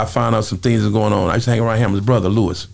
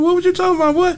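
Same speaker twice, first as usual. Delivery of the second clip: high, incredulous question; can't believe what he heard; replaying his disbelief way up high; retold disbelief. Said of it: "I said".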